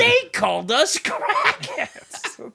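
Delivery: high, loud, drawn-out indignant voice; mock outrage. Offended crackheads repeating the insult, mock outrage. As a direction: draw it out in laughing mock offence.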